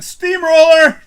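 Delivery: loud, high and slow shout; loud drawn-out call. Acted. Man reading a fan's joke aloud, loud and stretched. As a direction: belt out the word long.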